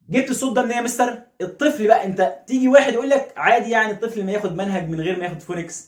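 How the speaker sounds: ordinary delivery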